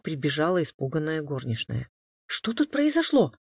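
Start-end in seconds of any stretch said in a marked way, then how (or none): 2.3-3.3 high, anxious questioning; alarmed question. A narrator's reading. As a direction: ask in fright, pitched right up. Frightened maid runs in asking.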